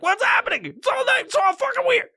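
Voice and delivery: very high, squealed mock panic; freaking out. Stoner through a wormhole, freaking out. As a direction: cry out in comic bewilderment. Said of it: "That's why I was freaking out".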